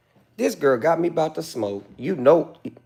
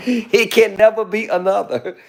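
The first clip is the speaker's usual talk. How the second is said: breathy laughter through words; laughing; 3 semitones higher than usual. Real, not acted. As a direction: laugh while saying it. Laughing at own joke.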